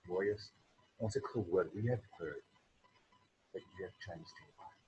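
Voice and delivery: hushed, halting retelling of her words; retold hushed words. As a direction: quote her quietly, with pauses. Voicing a woman's solemn welcome.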